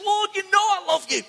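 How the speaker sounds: high, pained insistence; hurt protest, pitched right up. A narrator's reading. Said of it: "Peter was hurt".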